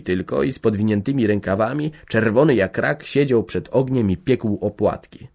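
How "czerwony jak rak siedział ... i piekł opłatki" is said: ordinary delivery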